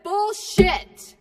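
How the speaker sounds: a loud, blunt shout of "Bullshit"; shouted rejection. Real, not acted. Rally speaker dismissing defeatism, loud shout. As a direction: slam the word down hard.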